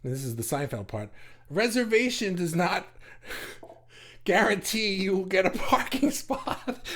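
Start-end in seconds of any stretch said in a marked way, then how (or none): 1.5-6.8 laughing read. Acted. Reading parking notice as Seinfeld joke.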